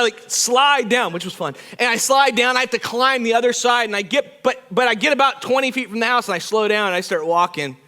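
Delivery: ordinary delivery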